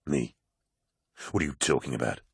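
a giant suddenly thrown off; confused. Acted. Loken, confused, asks the poet what he means, low and baffled. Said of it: "obviously confused".

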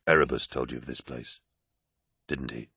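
low, hushed question; quiet accusation. Acted. Loken confronts Targost about Erebus, quietly accusing. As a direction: accuse softly, dropping away at the end.

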